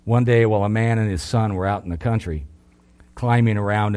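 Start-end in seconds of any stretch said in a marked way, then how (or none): none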